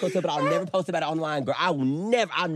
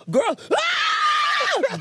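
put-on high, loud shrieking "Girl, ah!" with a laugh; hammed-up squeal of a fan; mock shriek; 8 dB louder than usual. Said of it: "They say".

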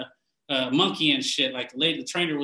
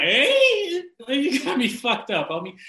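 startled laughing yelp; 8 semitones higher, slower than usual. Retold or imitated. Caught off guard mid-bite.

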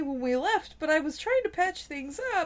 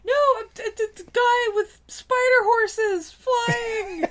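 loud, slow panicked cry; 6 semitones higher, 6 dB louder, much slower than usual. Acted. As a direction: blurt it out in panic. Panicked Aladdin explaining.

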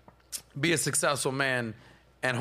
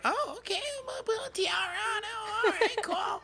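put-on girly wife voice. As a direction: squeak it like a delighted wife. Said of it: "She'll be like".

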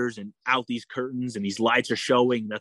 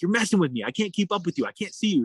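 higher, whiny retold complaint; half-joking gripe at a teammate, pitched up; mock frustrated protest; 7 semitones higher than usual. Swimmer complains to Laura in a higher, mock-annoyed voice. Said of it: "I'm like".

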